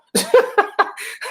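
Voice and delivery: a sudden high laugh; high breathy laugh. Real, not acted. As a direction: laugh at how ridiculous it is. Laughing at racist show.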